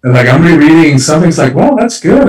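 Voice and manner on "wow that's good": pleasantly surprised. Retold or imitated. Author rereading her own book, impressed, high surprised wow.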